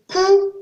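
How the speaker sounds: cou on a high, level, loud tone. Shown as a demonstration. Teacher giving an example word, cou on high tone. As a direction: hold cou high and even. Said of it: "Avec ou".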